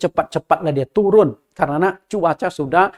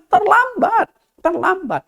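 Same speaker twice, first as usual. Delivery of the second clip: rising urgent cry of too late, then dropping; urgent warning; 12 semitones higher, slower than usual. Real, not acted. Preacher warning the congregation, urgent and high. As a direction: press the warning home, pitch high.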